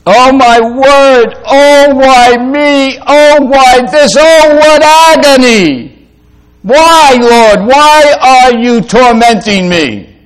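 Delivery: high, loud, slow hammed-up whine; mock whining complaint. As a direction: wail like a self-pitying grumbler, overdone. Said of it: "natural for us all to grumble".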